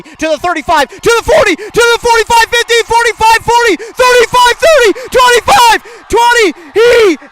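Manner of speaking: building to a high, loud shout; touchdown call. Real, not acted. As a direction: scream the return all the way home. Punt return touchdown call.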